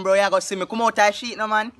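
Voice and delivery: ordinary talk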